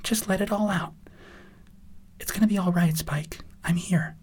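high, tender reassurance; high, gentle comforting voice. Acted. Twilight comforts the sobbing Spike.

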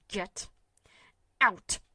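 short, sharp dismissal; curt. A narrator's reading. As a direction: cut him off curtly. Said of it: "The response was as curt as it was quick".

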